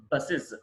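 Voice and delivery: బసెస్ as "bus-iz"; "-iz" ending. Shown as a demonstration. Teacher correcting learners' plural, బసెస్ as "bus-iz". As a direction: end బసెస్ on short i and z. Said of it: "ఇజ్".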